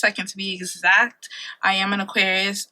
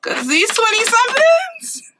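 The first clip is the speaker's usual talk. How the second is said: mock wail; 11 semitones higher, 3 dB louder, slower than usual. Dramatic wail about her twenties.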